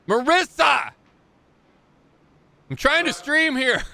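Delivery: loud, high call across the room. Real, not acted. Calling out to someone mid-stream.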